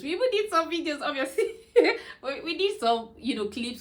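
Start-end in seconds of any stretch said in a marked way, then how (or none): 1.6-2.2 sudden loud high laugh; high burst of laughter. Real, not acted. Laughing about filming the hospital.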